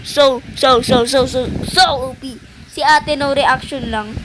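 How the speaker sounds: ordinary talk, nothing marked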